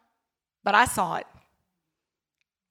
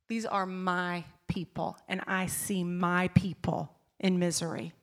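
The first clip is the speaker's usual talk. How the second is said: hushed tender quote; 4 semitones lower, 8 dB quieter than usual. Retold or imitated. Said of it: "He says".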